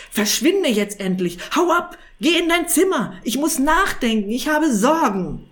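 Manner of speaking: loud, high, exasperated outburst; impatient snort. A narrator's reading. Sorcerer sending the cat to his room, impatient. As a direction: drive him out with a volley of impatient shouts. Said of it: "Irrwitzer schnaubte ungeduldig".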